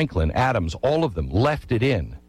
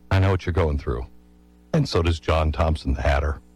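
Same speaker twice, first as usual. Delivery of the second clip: lightly voiced old Franklin, low and soothing; gentle old-man comfort; 9 semitones lower, faster than usual. Old Franklin comforts young Jefferson.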